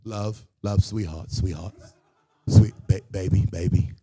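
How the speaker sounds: lightly put-on British accent, low. Shown as a demonstration. Comic tries out his safe word, British accent. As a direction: try out British endearments in a low voice. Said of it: "British accent like Idris Elba".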